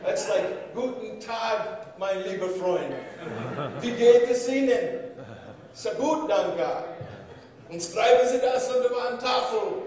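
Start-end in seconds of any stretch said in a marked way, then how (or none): 0.7-5.1 cheerful Bavarian German greeting, getting louder. Retold or imitated. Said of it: "have a Bavarian accent". 5.7-9.8 loud Bavarian German classroom reply. Retold or imitated. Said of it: "have a Bavarian accent".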